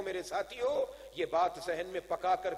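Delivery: ordinary talk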